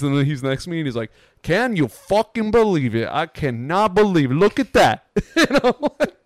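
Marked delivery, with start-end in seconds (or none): 1.4-5.1 high, incredulous Italian New York impersonation; Italian New York accent. Retold or imitated. Imitating the fan watching Judge bat, Italian New York accent. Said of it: "stereotypical most Italian New York dude".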